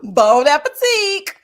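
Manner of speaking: slow, loud, cry-laughing; laughing, near crying. Retold or imitated. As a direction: stretch it out while cracking up. Mocking clip's line, laughing.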